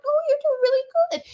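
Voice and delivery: high quick cooing praise; high fast babying praise; baby talk. Retold or imitated. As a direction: cheer on the ferments like toddlers. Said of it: "I talk to 'em".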